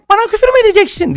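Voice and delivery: high, fast imitation of a girl; girl's voice. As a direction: squeak it like an angry girl. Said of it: "diye bir ses geldi".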